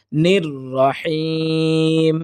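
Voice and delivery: "নির রহিম" slow, rolled r, breathy throat h, long "heem"; correct tajweed. Shown as a demonstration. Teacher modelling the verse ending, careful tajweed. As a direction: stretch the last syllable of "নির রহিম". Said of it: "সহি হচ্ছে".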